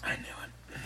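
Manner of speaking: hushed knowing whisper. Real, not acted. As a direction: breathe it under your breath. Teasing reveal of friend's taste.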